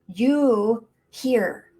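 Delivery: normal talking voice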